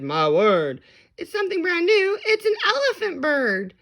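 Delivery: high, loud and fast; excited shout. A narrator's reading. Crowd naming the elephant bird, high fast shout. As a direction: blurt out the discovery. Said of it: "they shouted".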